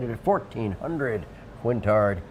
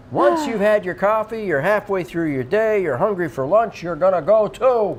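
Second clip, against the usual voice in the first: excited pitch; 6 semitones higher, 5 dB louder than usual. Acted. Building up an ad pitch.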